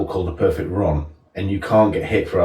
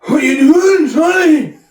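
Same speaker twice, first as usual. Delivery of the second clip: loud, very high Scottish shout; hammed-up Scottish holler; exaggerated high-pitched Scottish; Scottish accent; 7 dB louder, slower than usual. Shown as a demonstration. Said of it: "I can do Scottish though".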